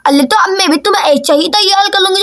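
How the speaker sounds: ordinary talk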